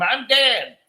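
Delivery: loud, high retold outburst; sudden interruption. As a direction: burst in out of nowhere, imitating him. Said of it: "out of nowhere he came".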